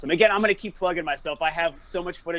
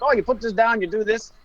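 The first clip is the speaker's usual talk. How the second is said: lightly imitated German accent, pitched up; 5 semitones higher than usual. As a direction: count it off like a German demo guy. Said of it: "like a German accent or something".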